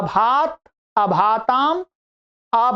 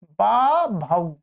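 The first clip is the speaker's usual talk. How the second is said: slow answer; 2 semitones lower, much slower than usual. Shown as a demonstration. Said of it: "तो क्या बनेगा?".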